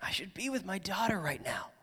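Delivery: whispered guilt of a worried father; anxious whisper. Retold or imitated. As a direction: whisper it to yourself. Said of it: "imagine what's going through his mind".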